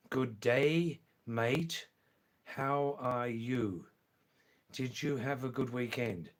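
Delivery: careful, drawn-out greeting; slow and deliberate. Shown as a demonstration. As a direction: say it slowly so a learner understands. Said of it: "I'll say it slowly this time".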